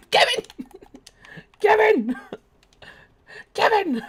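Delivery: high loud calls for Kevin; calling out. Acted. Streamer calling for vanished teammate Kevin, high loud calls. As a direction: shout after a lost friend, hammed-up.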